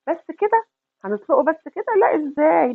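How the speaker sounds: high playful self-question; mock surprise. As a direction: act surprised it's that simple. تساؤل مصطنع ثم رد متحمس. (دهشة مصطنعة مرحة)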